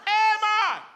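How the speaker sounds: hammed-up Jamaican pilot shouting; Jamaican pilot yelling. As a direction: yell out the window like a lost Jamaican pilot. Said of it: "I hear my pilot yelling".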